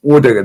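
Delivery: "Odegaard" repeated in imitation of native speakers; proper Norwegian pronunciation. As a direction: stress the first syllable of "Odegaard" as the Norwegians do. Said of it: "pronounced his name properly".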